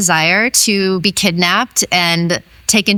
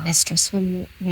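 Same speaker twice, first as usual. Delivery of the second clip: hushed, barely voiced plea, much softer; almost a whisper; 8 dB quieter than usual. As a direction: mumble the request as if ashamed to say it. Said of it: "almost like a whisper".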